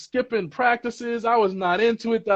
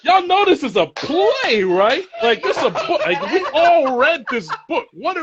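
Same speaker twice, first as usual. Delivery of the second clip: high-pitched, laughing disbelief; can barely hold the laugh in; 11 semitones higher than usual. Retold or imitated. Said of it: "I was like".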